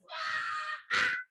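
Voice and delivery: very high squealing cackle; shrieky mock laughing fit; imitated cackling. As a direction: mimic two friends cracking up. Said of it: "they're both just cackling".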